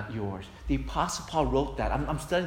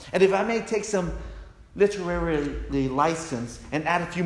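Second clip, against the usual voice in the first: louder, higher and driving, each contrast hammered; full-force preaching; 6 semitones higher, 6 dB louder than usual. Acted. Pastor urging the congregation on their faith, in louder full-force preaching. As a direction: preach it to the rafters, building each line.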